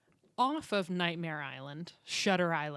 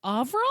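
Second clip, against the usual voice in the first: AVRIL higher and louder, rising as a question; puzzled echo; 5 semitones higher, 6 dB louder than usual. Retold or imitated. Student still not getting the name, AVRIL as baffled question. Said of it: "you've lost me".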